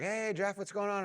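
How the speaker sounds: high bright mock hello from church friends; imitating cheery churchgoers calling out; friendly greeting. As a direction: chirp it up high like friends spotting you.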